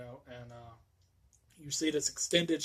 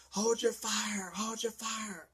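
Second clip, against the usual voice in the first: much higher, slow, strained command; sheriff calling out; 8 semitones higher, slower than usual. Retold or imitated. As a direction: call off the shooting in anguish. Bracken calling off the cops.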